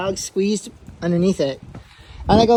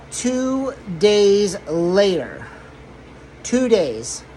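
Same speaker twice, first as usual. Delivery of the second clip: drawn-out dramatic; much slower than usual. Pretend.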